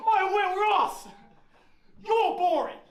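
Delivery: slow, loud jeering call; mock shout. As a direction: call out across the pitch, mocking. Kids shouting at ball boy.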